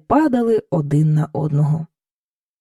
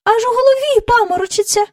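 higher, echoing chorus of courtiers; 14 semitones higher, 3 dB louder, faster than usual. Acted. Courtiers echo the king, higher and sing-song. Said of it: "луною підхопили всі придворні".

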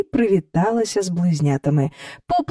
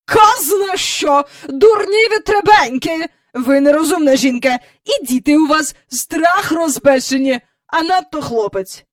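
high, loud dismissal easing into lecture; scornful scold; 8 semitones higher, 5 dB louder than usual. A narrator's reading. Visitor berating the hostess, scornful. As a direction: dismiss her contemptuously.

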